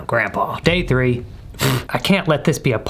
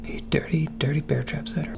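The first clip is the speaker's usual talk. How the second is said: whispering; 2 semitones higher, 8 dB quieter than usual. Real, not acted. Caught in trap, hiding.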